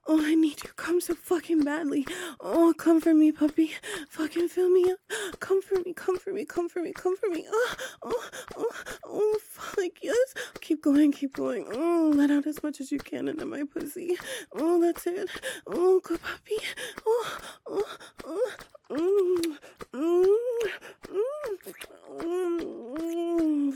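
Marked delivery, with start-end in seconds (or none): 0.1-10.3 higher, much louder, rising to frantic cries; building climax. Acted. Climax of the scene. 10.6-11.1 louder, higher urging; urgent plea. Acted. Urging him during climax. 11.7-23.7 higher, much louder, rising to frantic cries; building climax. Acted. Climax of the scene.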